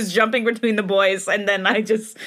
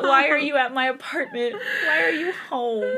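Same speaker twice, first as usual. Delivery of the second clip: crying; 6 semitones higher than usual. Real, not acted. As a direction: read the line through real tears. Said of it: "I'm crying".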